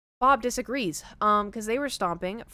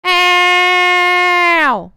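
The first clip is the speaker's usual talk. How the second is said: high, very loud imitated cat scream; cat yowl; 10 semitones higher, 18 dB louder than usual. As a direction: yowl like the cat on your head. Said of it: "I am Thor".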